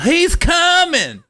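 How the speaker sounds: high, slow alarmed yell; yelled warning. Retold or imitated. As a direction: shout the warning to the whole park. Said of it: "three people yelled".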